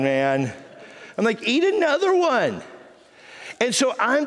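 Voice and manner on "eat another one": high, goading retold taunt; teasing dare. Husband retelling how he dared wife to eat it, teasing. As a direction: egg them on with a big jump in pitch.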